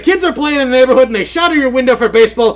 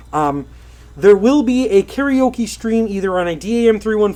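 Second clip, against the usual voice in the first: Kermit-style news voice; 2 semitones lower, 4 dB quieter than usual. Acted. Streamer announcing sad Twitch news to chat in his Muppet voice. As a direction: deliver the news like a Muppet anchor. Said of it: "deliver it in the style you're used to hearing".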